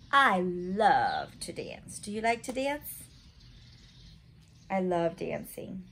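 usual speaking voice

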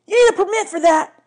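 high, loud shout after a runaway; police officer hollering loudly; shouting policeman. A narrator's reading. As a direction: yell it as you chase. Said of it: "running after them shouting".

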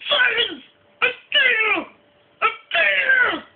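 high-pitched comic threat yell; hammed-up furious screech. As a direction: screech it like an enraged puppet villain. Angry terrorist puppet yells threat.

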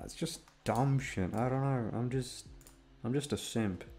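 ordinary talk

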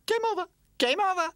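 very high, loud electronic chant; Charlie Chip game voice. Acted. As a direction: announce it like a toy game. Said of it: "said Charlie Chip".

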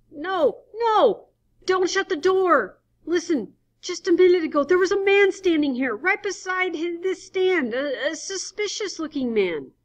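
high, loud frantic pleading. Acted. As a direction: beg them not to shut the door. Adams frantic at the door.

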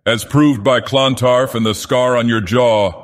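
ordinary delivery, nothing marked

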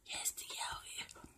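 slow, barely audible whisper; very soft, drawn-out whisper; hushed whisper. Real, not acted. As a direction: whisper it slowly to yourself, savoring it. Sudden hushed repeat after exclaiming.

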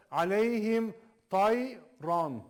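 slow chanted recitation; Quran recitation. Shown as a demonstration. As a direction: recite the verse slowly, with tajweed. Recites words. (yavaş tecvidli tilavet)

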